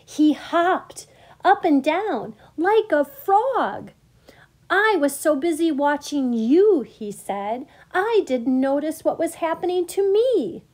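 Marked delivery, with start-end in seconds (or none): 0.0-3.9 high, loud, slow narration; delighted emphasis. A narrator's reading. Narrator builds delight at the reveal. 4.7-6.8 high, loud, delighted frog; excited. A narrator's reading. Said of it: "The pollywog was so excited". 7.9-10.6 high, loud, amazed delight; excited. A narrator's reading. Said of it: "The pollywog was so excited".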